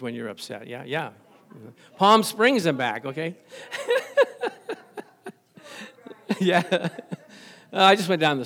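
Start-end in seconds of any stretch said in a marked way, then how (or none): 3.4-4.7 high far laughter from the room. Real, not acted. Congregation laughing at joke.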